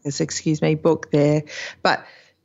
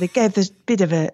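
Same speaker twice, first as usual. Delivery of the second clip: put-on light Dutch accent. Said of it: "she has a little Dutch accent".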